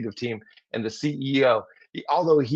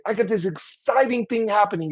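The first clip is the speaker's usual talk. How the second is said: higher, eager announcement; excited; 8 semitones higher than usual. Shown as a demonstration. Colleague sharing exciting news, eager and higher. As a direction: share the exciting thing. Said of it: "a tone that matches the tone".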